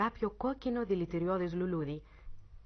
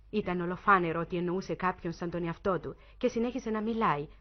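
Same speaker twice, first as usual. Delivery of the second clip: ordinary delivery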